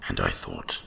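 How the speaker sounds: usual speaking voice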